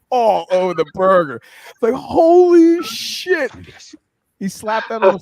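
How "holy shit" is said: drawn-out, much higher and louder gasp of awe; amazed exclamation. Retold or imitated. Friend watching queso flood the burger, slow amazed cry. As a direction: stretch it way out, high and loud, like you can't believe it.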